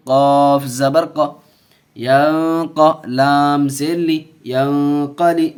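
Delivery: loud, slow syllable-by-syllable chanting of the letters; drawn-out classroom recitation; spelling-drill recitation. Shown as a demonstration. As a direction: call each letter and vowel out for the class to repeat. Teacher spells and recites drill words.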